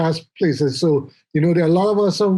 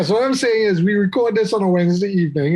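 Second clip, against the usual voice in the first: usual speaking voice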